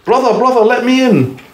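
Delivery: very high pleading call; pleading wolf-pig. A narrator's reading. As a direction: beg at the brick door, high. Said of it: "he said to his brother".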